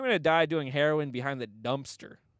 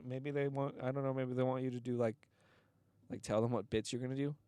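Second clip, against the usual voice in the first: timid; 3 semitones lower, 6 dB quieter than usual. Retold or imitated. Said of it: "very timidly".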